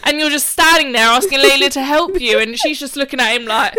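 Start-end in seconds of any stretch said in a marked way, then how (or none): 0.0-2.4 higher, louder exasperated shout; retold angry rant. Sister scolding brother for the mess, angry. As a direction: berate him at full volume. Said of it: "And I'm like".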